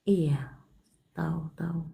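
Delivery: breathy whispered agreement. Real, not acted. Tarot reader whispering to a tearful caller that she already knows. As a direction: hush it, just air, no voice. Hushed reply while caller opens up.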